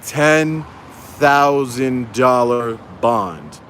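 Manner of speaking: very slow, word by word; drawn-out repeat for those who missed it; emphatic repeat. Shown as a demonstration. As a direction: spell out "Ten thousand dollar bond" slowly, pausing after "Ten". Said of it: "let me repeat that for those of you who didn't hear".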